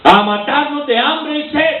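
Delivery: high, whiny mock grumble; mock whining complaint. Preacher mocking the grumbling Israelites, high whine. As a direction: mimic the ungrateful crowd.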